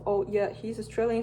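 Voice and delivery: ordinary delivery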